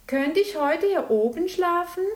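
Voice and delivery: put-on high, loud childlike question; hopeful little bear. Little bear asks to sleep up there, hopeful question. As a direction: ask like a hopeful cub.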